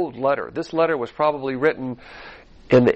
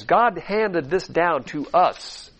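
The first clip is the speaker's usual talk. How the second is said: ordinary talk, nothing marked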